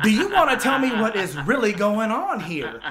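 high, shouted demand; unhappy buddy retold. Buddy demanding the truth in the truck, angry. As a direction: act out an exasperated buddy yelling for answers. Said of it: "he ain't none too happy with me".

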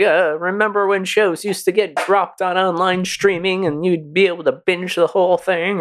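high whiny mock complaint; mock whiner. Podcaster mocking upset fans in a whiny high voice. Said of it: "It's like".

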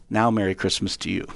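low, gentle retold cashier; tender giving. Cashier quietly returns the kindness.

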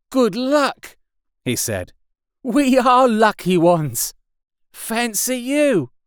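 relieved survivor's welcome; excited greeting. A narrator's reading. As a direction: artilleryman glad to meet him. Artilleryman greets.

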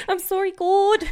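high, loud mock British confession; British accent. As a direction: squeal it in a hammed-up British accent. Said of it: "should I do it in a British accent?".

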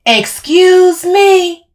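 slow, high, loud bellow; indignant bellow. A narrator's reading. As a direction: bellow it out in outrage, stretched long. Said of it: "Camille bellowed".

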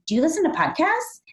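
high, lilting come-on question; lightly acted booth pitch, rising at the end; luring invitation. Hosts lure passers-by to the podcast booth, inviting. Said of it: "luring people into the booth by saying".

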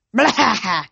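goat-like startled bleat; sudden bleat of disgust. A narrator's reading. Grover scared, exclaiming.

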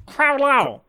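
imitating a squawky little kobold; imitated kobold chitter. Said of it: "try and meet him where he's at".

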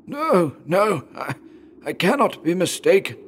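high, halting dying-man voice; painful insistence. A narrator's reading. Father contradicts son about the bells, in pained insistence. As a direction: insist through the pain, stumbling on words. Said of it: "slowly and painfully".

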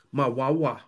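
put-on lightly babyish word Wawa; baby talk. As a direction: say My Wawa like a toddler. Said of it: "Are you a baby?".

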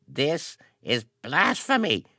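put-on high, croaking old priest. A narrator's reading. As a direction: croak it out in outrage. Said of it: "he croaked".

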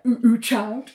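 lightly mocking echo with a laugh; gentle mock repeat. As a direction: mimic the title teasingly. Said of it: "mocking how she said it".

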